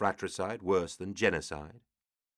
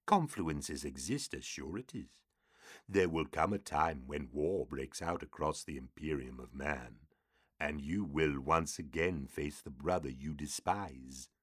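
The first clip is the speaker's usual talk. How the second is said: eerie, low oracle demon; ominous demon speaking prophecy; 4 semitones lower, 6 dB quieter than usual. A narrator's reading.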